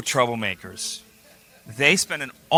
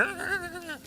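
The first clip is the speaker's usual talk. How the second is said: mock lion roar; 11 dB quieter than usual. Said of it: "And he'd be like".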